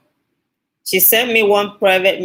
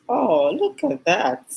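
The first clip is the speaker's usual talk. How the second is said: a slow, high, delighted "oh, look at that"; drawn-out pleased gasp of surprise; touched surprise; 7 semitones higher, slower than usual. Real, not acted. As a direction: melt a little at a kind comment. Reading a touching viewer comment.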